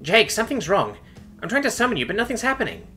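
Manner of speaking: very high, worried female voice; high-pitched anxious plea; panicked. A narrator's reading. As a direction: report trouble in rising alarm. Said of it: "Suma sounded panicked".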